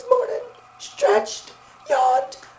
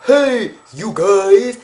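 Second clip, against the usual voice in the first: much lower, voice dropped; deep greeting; 4 dB louder, much slower than usual. Shown as a demonstration. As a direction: say hey you guys very low. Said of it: "For me to be like".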